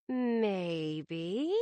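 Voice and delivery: each syllable stretched long; drawn-out syllables. Acted. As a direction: stretch out every syllable teasingly. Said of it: "She elongated each syllable".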